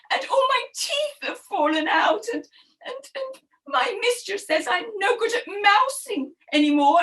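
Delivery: high, whining cat voice; high plaintive moan, stumbling over the ands; woebegone old cat. Acted. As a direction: wail your troubles like a pitiful old cat.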